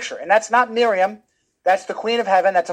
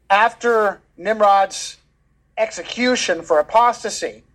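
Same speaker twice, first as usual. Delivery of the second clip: ordinary talk, nothing marked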